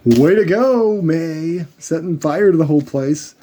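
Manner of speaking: sarcastic. Retold or imitated. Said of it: "It's like".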